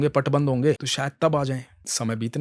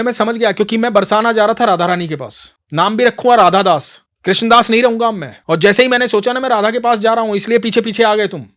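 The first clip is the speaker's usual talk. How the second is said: much higher, louder accusing rant; complaining quarrel; 8 semitones higher, 9 dB louder than usual. Acted. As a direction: throw the accusation at him. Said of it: "शिकायत और झगड़ा करते करते".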